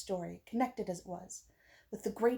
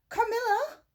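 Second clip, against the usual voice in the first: loud, high call of a name; 6 dB louder than usual. A narrator's reading. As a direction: call her through every door. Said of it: "She then began to call".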